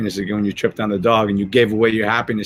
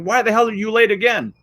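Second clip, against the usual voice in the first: boss scolding; 10 semitones higher than usual. Retold or imitated. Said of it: "your boss comes and says".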